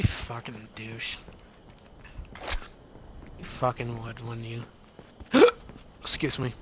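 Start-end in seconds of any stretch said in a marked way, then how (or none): 5.2-5.7 loud sudden hiccup; a sharp, high hiccup. Real, not acted. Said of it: "Got the hiccups".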